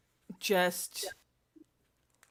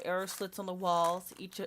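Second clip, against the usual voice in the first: ordinary delivery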